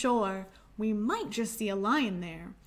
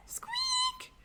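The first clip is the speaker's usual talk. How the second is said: very high tiny squeak; mouse squeak; 5 dB quieter than usual. Shown as a demonstration. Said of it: "a mouse sounds like this".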